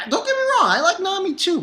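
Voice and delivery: high, loud squeaky protest; high-pitched defensive fanboy aside; fanboy squeal. Real, not acted. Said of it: "I'm such a Robin fanboy".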